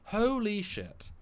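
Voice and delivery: high, drawn-out amazed exclamation; stunned disbelief. Retold or imitated. Viewer reacting to endless advert breaks, stunned disbelief. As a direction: stretch it out slowly in high-pitched amazement. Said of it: "I'm like".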